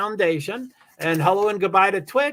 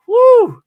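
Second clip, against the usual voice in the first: high, loud "woo"; 14 dB louder than usual. Real, not acted. Streamer moved by a viewer's kindness, loud high woo. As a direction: let out a sudden high whoop.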